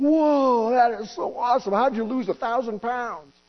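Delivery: hammed-up astonished doctor, slow at the end; amazed doctor. Doctor gushing to patient over lost weight, mock amazed. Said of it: "the doctor's going".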